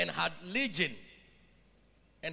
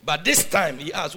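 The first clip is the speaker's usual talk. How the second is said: ordinary talk, nothing marked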